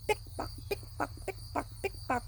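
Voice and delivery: soft breathy pick pock; high heel clicks. A narrator's reading. Reading to children, soft clicking heels. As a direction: tap it lightly like heels. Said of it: "The lady's high heels go".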